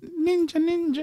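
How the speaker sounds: high-pitched Japanese caricature; Japanese accent. Pretend. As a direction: toss out words in a crude Japanese accent.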